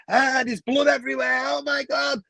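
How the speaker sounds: imitating a horrified woman's scream; mock scream. Woman finding blood in the toilets, mock horrified shriek. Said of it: "started screaming and went".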